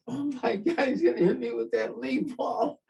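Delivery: sudden fear of being hit; panicked thought. Retold or imitated. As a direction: recruit bracing for a fastball, alarmed. Recruit fearing thrown baseball.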